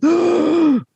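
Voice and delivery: high, loud imitated gasp of surprise. Host imitating his wife watching the twist, sharp high gasp. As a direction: gasp like you just saw the twist. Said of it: "And Joanne's like".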